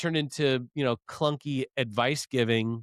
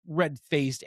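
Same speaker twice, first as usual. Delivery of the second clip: normal talking voice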